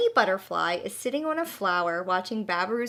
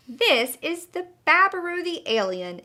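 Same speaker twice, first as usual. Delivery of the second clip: delighted exclaim; 6 semitones higher, 3 dB louder than usual. A narrator's reading. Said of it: "exclaims the hedgehog in delight".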